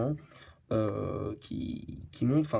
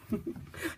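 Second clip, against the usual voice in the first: stifled, breathy giggle; real suppressed laugh; giggling; 9 semitones higher, 11 dB quieter than usual. Real, not acted. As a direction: break up laughing mid-sentence. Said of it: "je rigole".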